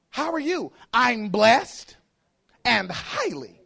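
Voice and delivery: loud mock churchgoer exchange; church lingo. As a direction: act out an emphatic church greeting and reply. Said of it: "you don't talk like this nowhere else".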